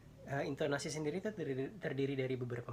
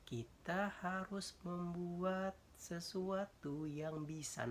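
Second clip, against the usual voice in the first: very slow, higher-pitched, drawn-out delivery; deliberately slowed demonstration, pitched up; slowed tempo; 6 semitones higher, much slower than usual. Shown as a demonstration. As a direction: stretch each syllable out slowly to show tempo. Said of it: "di-, dilambankan".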